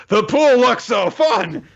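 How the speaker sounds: high, loud, drawn-out forced enthusiasm; mock an awkward kid announcing it much too loudly; awkward cheer. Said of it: "she very awkwardly goes".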